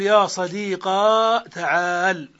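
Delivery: "صديقا" ending in a long ā; slow demo, hold the final aa of "صديقا"; ya turned to alif. Shown as a demonstration. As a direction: say sadīqā for "صديقا", last vowel drawn out. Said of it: "بقلب الياء ألفا".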